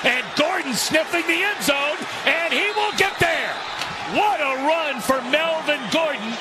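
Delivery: high excited shout; touchdown call. Real, not acted. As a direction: call the score with a lift.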